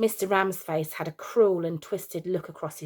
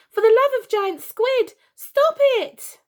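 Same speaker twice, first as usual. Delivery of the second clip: much higher, very loud pleading; child shouting; 8 dB louder than usual. Acted. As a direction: shriek at mum to stop. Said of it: "shouted little Billy Ramsface".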